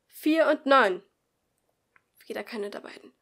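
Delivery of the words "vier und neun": loud, higher announcement; calling out. Acted. Teacher reads out the group numbers, loud. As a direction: read the pair out to the room.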